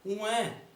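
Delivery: high-pitched mock baby wail; sudden high baby cry, put on; imitated newborn cry. Comedian acting out his own birth, mock newborn cry.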